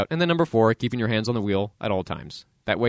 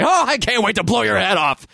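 mock trigger-happy cop; 9 semitones higher, 4 dB louder than usual. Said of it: "take out their guns and say".

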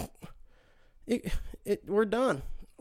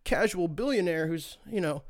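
mock-innocent Trump; 3 semitones higher than usual. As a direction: parody of a politician excusing himself. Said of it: "he finds a way to be like".

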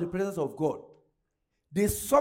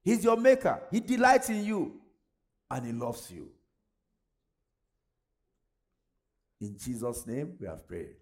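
hushed prayer; 6 semitones lower than usual. Acted. Quiet prayer over the congregation.

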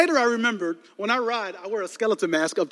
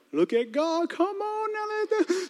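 squeaky high, softened praise; hushed high exultation; 12 semitones higher, 6 dB quieter, slower than usual. Retold or imitated. Crash victim sees a car coming after praying, high exultation. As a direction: soar into a thin falsetto, rejoicing without volume. Retold reaction to an answered prayer.

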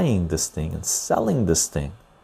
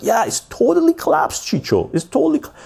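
high mocking impression of the nurse. Mimicking the nurse friend who finally admits it's collapsed, in a high mock voice. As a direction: squeak it out much higher, mocking her sudden agreement. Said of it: "She's like".

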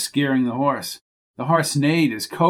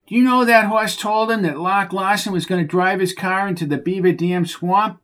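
higher, whiny voice of a dim stable hand; exasperated Bucky; 6 semitones higher than usual. Acted. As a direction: scold the horse like a fed-up oaf. Bucky tells gossip.

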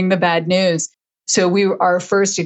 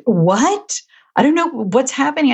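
imitating stunned staff, higher and rushed; mock shock, words tumbling out fast; gobsmacked; 7 semitones higher than usual. Office staff hearing the cancer news, gobsmacked and high. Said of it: "they were gobsmacked".

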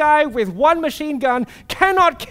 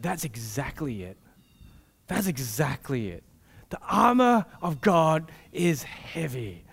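dead serious leader; 8 semitones lower, 6 dB quieter than usual. Retold or imitated. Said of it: "my leader was dead serious".

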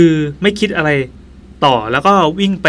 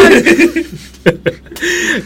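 high real laughter bursting out; 14 semitones higher, 3 dB louder than usual. Real, not acted. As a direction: laugh high and loud at the punchline. Laughs at own joke. (หัวเราะเสียงสูงลั่น)

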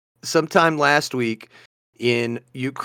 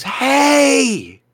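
high, long-drawn hey across water; calling out; 10 semitones higher than usual. Retold or imitated. Man on an island hails a stranger on shore, calling out. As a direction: holler to someone far away. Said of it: "you hear a guy goes".

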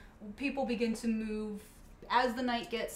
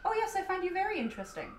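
fast, higher, blunt bard; deadpan bard voice; 9 semitones higher, faster than usual. Acted. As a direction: rattle off the compliment. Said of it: "your default bard voice".

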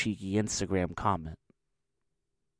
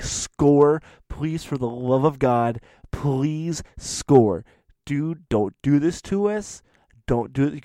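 louder, higher begging; mock desperate plea; 4 semitones higher, 5 dB louder, slower than usual. Bettors begging the player to score, loud mock plea. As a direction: beg him like your money rides on it.

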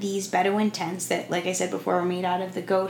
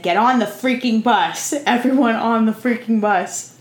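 put-on loud Israeli accent order; 3 semitones higher, 5 dB louder than usual. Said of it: "in his Israeli accent".

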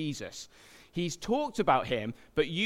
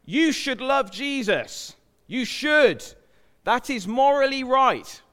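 loud slow preachy scolding, higher; mock shouted command; 7 semitones higher, 7 dB louder, slower than usual. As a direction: bark the command like a stern preacher. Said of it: "shout at them".

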